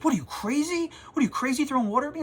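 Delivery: put-on high, fast, outraged shriek of a teen; mock a kid squealing in disbelief, fast; indignant kid yelling. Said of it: "And he goes".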